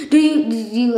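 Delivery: ordinary talk, nothing marked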